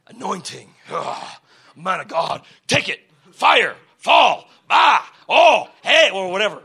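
loud, mock charismatic cries; mocking emotional church shouting; mock hype prayer. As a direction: ham up a hyped-up prayer meeting. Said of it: "I used to think it was all the hype".

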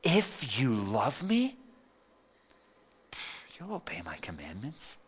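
a loving invitation, almost breathed; gentle loving Jesus. Retold or imitated. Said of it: "Jesus said it like this".

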